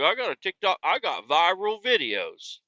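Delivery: a touch of the squeaky geezer showing off; old man voice. Retold or imitated. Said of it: "He's like".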